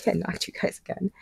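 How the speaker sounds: normal talking voice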